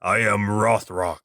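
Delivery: low, solemn guardian voice; guardian god. Acted. Imagining Rothrock as a guardian looking over you, solemn. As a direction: intone it like a mighty Norse guardian. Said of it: "some kind of guardian that would be looking over you".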